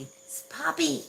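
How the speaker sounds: sharp, high call to a pet; real scolding call. Real, not acted. Owner stopping Poppy scratching the screen, high scolding call. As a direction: raise the pitch to get its attention.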